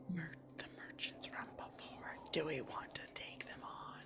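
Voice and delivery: whispering. Acted. Party whispering near door.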